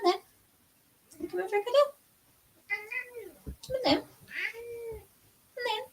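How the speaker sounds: high, soft coaxing call to the cat. Real, not acted. Host coaxing her cat Fiona to come in, high call.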